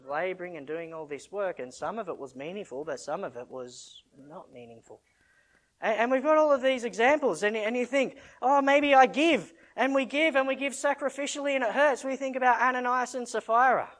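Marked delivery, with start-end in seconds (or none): 5.8-13.9 loud, rising sermon push; full-force preaching. Real, not acted. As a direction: preach it hard to the congregation.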